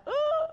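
a caught-out yelp of ooh; sharp high ooh. Retold or imitated. Reacting to God's rebuke.

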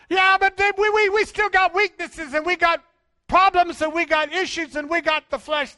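high, loud protesting believer; hammed-up believer protesting his weakness; mock objection. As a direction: whine the excuse back at the preacher. Congregation's objection voiced.

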